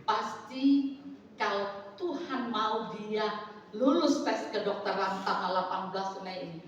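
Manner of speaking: ordinary talk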